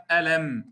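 "ألم" with the final م kept short; correct ghunnah length. Shown as a demonstration. Teacher showing students the correct reading, "ألم" with a short م. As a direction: close "ألم" on a brief, measured hum. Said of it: "والصواب قوله تعالى".

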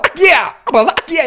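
high cracked "yeah" after a chopped "verdad"; broken-voice scratch phrase; vocal scratch. Shown as a demonstration. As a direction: squawk "yeah" like a DJ sample. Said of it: "Ya le pueden dejar a ustedes el yeah".

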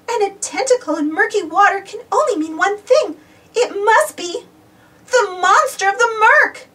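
submariners realize the monster has them, high frightened cry; frightened gasp. A narrator's reading. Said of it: "gasped the submariners".

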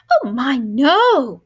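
put-on loud but tender old woman; kind gentle reply. A narrator's reading. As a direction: gently reassure like a grandma. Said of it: "replied the kind old woman".

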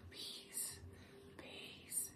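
soft whispered affirmations; whispered pep talk. Acted. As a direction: whisper encouragement close to the mic.

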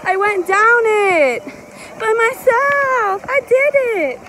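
high, loud, drawn-out triumph; proud exclamation. Real, not acted. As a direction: stretch it out, crowing with pride. Bragging about conquering the slope.

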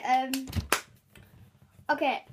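normal talking voice

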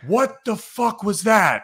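high, slow, incredulous shout; floored captain yelling; stunned yell. Retold or imitated. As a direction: let it out in total disbelief. Captain stunned by ladder throw.

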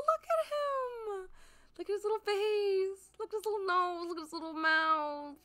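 high-pitched squeaky cooing over something adorable; cuteness squeal. Real, not acted. Player pointing out the little villager's features, squealing adoration. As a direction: gush in a tiny, very high squeal.

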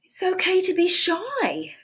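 bright, high storybook read; sing-song read-aloud for little kids; reading to children. A narrator's reading. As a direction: read it like a cheerful picture book. Reading picture book aloud.

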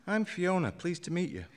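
chirpy greeting to the urn; bright young woman. A narrator's reading.

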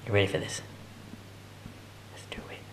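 hushed, suspenseful whisper. Real, not acted. Owner watches Lily and Ollie meet, whispering. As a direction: whisper a running commentary without disturbing them. Narrating cat and dog meeting quietly.

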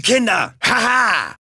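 usual speaking voice

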